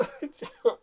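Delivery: high mock sob; a short hammed sob before speaking. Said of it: "He says".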